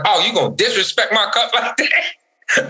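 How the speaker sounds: high-pitched mock outrage at a child; retelling a scolding, pitch climbing in indignation; indignant parent retelling. Dad retells confronting his four-year-old over milk, indignant. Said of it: "This is me and my, my four-year-old talking".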